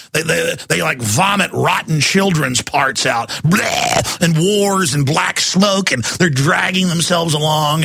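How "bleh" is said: short retching bleh; hammed-up vomiting noise; mock retch. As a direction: gag as if vomiting. Imitating goblins vomiting.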